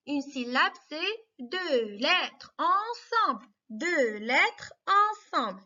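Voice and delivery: put-on each word drawn out slowly for young pupils; slow, patient classroom delivery; slow teacher explanation. Shown as a demonstration. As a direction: spell it out slowly, like to small children. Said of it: "teacher defining a syllable slowly".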